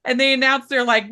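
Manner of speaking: normal talking voice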